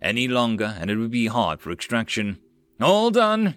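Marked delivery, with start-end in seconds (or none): 2.8-3.5 higher, louder call to the men; yelled. Acted. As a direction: shout it across the deck. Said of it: "he yelled at his men".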